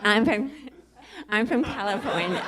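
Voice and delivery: softly imitated hometown answer; mimicking audience member. Comic teasing the woman from California, soft mimicry. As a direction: echo her in a small, soft voice. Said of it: "Look how she said it".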